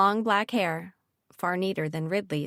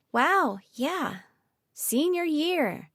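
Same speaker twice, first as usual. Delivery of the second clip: slow, higher fake cheer; forced enthusiasm; 8 semitones higher, much slower than usual. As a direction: pretend to be excited. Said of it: "tried to inject some enthusiasm into her voice".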